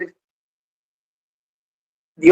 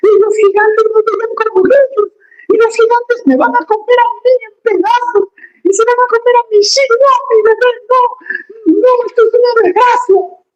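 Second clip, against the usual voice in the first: hammed-up high whimpering lament; whiny crying Israelites. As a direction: wail it like a scared crybaby. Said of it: "lloraron y gritaban".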